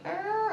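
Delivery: high creaking noise; imitated floorboard creak. Parent sneaking out of child's room, mimicked creak. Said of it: "The, the floorboard's like".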